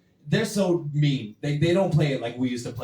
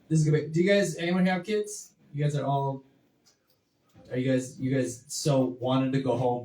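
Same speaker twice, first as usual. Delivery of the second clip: ordinary talk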